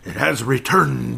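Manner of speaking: slow booming evangelist proclamation; fiery preacher sermon. Shown as a demonstration. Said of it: "I had to say it like an, uh, evangelist".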